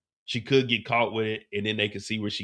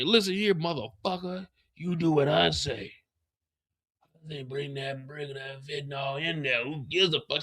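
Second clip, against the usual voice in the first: imitating an angry boss; 2 semitones higher, 5 dB quieter than usual. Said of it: "And Diamond loses it. He like".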